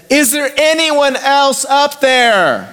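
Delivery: high, slow, sheepish call upward; hopeful second ask. Retold or imitated. Man on the cliff asks for anyone else, high and slow. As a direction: drag it out, hoping someone else answers.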